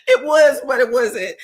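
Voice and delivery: a very high laugh spilling into the words; cracking up. Real, not acted. Reacting to Ashley's joke to viewers, laughing hard.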